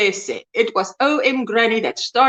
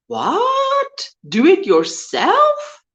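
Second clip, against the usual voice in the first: surprised; 9 semitones higher, much slower than usual. Retold or imitated.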